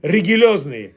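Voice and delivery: "rigilozny" with ri-gu-LOZ-ny, g before l; Bukharian mispronunciation. Shown as a demonstration. Repeating on request, Bukharian "rigilozny". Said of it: "how all the Bukharians say it".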